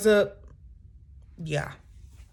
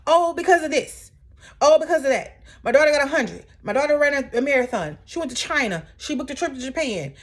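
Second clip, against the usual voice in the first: higher, louder mock rattle of excuses; 7 semitones higher, 3 dB louder than usual. Vlogger mocking Brittany's excuses, high and loud. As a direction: parody her flimsy reasons.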